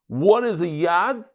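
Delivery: ordinary delivery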